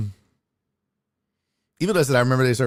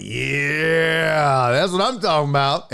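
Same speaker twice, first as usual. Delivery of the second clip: long drawn-out gratified "yeah"; savoring approval; slower than usual. Retold or imitated. Diner seeing fresh fries arrive, savoring approval. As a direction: stretch the first word out with relish.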